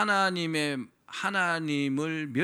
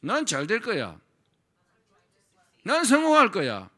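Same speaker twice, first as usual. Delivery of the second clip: loud, higher, triumphant affirmations; confident declaration; 9 semitones higher, 9 dB louder than usual. Shown as a demonstration. Preacher modelling bold positive speech for the congregation, loud and confident. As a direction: proclaim it like you already believe it. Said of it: "이렇게 말해야 합니다".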